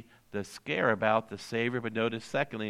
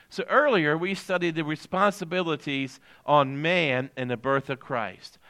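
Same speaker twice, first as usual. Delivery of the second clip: ordinary talk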